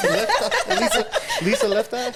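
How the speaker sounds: laughing high-pitched guess. Real, not acted. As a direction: blurt it out through a laugh.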